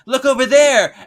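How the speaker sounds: high, loud "look over there"; pointing away with a big bright shout; mock excited call. Witch tricking Gino into turning around, with a sudden loud call.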